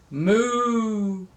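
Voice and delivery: comically held-out moo; funny moo. Retold or imitated. Grandma admitting her moos sound funny, mooing. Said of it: "my moos sound kind of funny".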